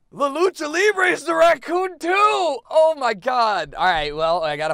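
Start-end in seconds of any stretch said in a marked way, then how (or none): 0.1-3.7 high, loud delighted shout; loud gleeful discovery; excited shout. Real, not acted. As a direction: yell out the reveal. Realises raccoon is another.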